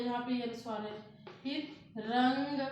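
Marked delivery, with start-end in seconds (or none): 2.0-2.6 "रंग" drawn out slowly through the nose; nasal anusvara. Shown as a demonstration. Teacher demonstrates anusvara for students, slow nasal "रंग". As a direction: stretch "रंग", holding its nasal sound. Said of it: "प्रयोग कर रहे हैं ना आप".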